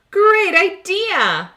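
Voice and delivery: high, slow, delighted cry of a great idea; excited exclaim. Acted. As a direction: squeal it out with joy. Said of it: "exclaims Emma".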